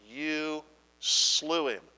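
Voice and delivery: slow and weighty, each word apart; slow, heavy delivery. Shown as a demonstration. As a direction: let each word land slowly. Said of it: "Let that sink in".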